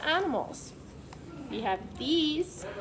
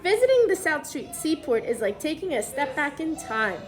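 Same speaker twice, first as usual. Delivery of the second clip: put-on clear, even storybook read-aloud for kids; reading to children; 4 dB louder than usual. Teacher reads the book to the class, storybook read-aloud voice.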